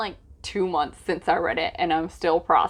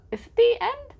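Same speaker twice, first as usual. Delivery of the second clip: high wondering voice; faster than usual. Real, not acted. Wondering aloud to herself.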